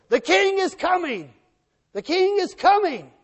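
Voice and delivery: high, loud proclamation shout; yelling herald. Acted. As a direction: cry it out from the front of the march. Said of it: "the ones in front are yelling".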